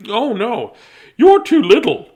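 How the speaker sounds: high, loud mother voice; a mother refusing fearfully; mother's warning. Acted. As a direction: protective mom voice. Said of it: "she said".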